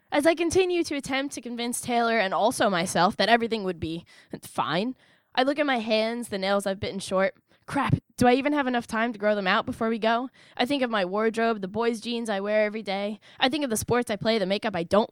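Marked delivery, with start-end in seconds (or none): none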